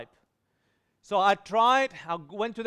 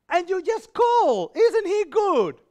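high, slow, tearful wife's voice; wife's tearful joy; 10 semitones higher, 8 dB louder, slower than usual. Retold or imitated. Wife on Skype overcome that he called, high and slow. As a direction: pitch way up and drag it out, overcome.

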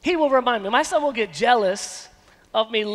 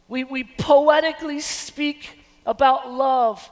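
usual speaking voice

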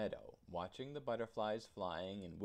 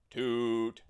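louder echoing toot; echoing shout; 2 semitones higher, 12 dB louder than usual. A narrator's reading. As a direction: boom back from the barrel. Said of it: "shouted the barrel".

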